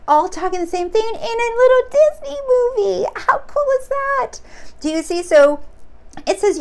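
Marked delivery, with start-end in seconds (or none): 0.1-4.4 much higher, delighted; amazed. Real, not acted. Amazed to her viewers at a little Disney movie. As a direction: squeal it with wonder.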